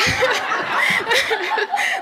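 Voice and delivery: rising, breathy burst of laughter; long high laugh. Real, not acted. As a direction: laugh at your own frustration. Laughs mid-story.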